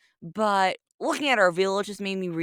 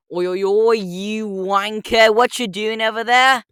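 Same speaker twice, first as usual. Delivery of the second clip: slow, drawn-out British heckling; British accent, calling out the Lorax; 3 semitones higher, 3 dB louder, slower than usual. Acted. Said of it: "British Walrus Detective".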